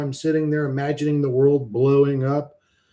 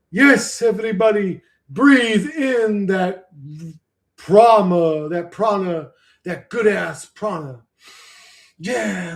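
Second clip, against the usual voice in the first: mock yoga teacher; 2 semitones higher than usual. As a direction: ham up a fake breathwork guide. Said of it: "put on a role".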